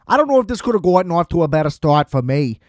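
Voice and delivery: weird hybrid Boston accent, hammed-up and quick; horrible Boston accent. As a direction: rattle it off in a bad Boston accent. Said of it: "that was some weird Boston hybrid, horrible accent".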